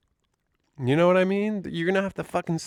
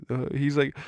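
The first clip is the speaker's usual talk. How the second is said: usual speaking voice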